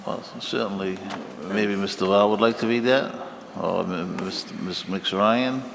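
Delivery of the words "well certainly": usual speaking voice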